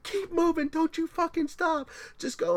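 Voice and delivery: high mock yell, easing at the end; shrill sideline cheer that fades off; imitating a scream. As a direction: urge him on in a high-pitched yell. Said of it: "and she's screaming".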